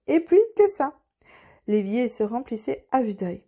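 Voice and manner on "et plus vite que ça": high, loud, urging cry; shouted order. A narrator's reading. Apprentice urges the brooms to work faster, shouting. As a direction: shout to hurry them along. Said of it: "Puis il criait".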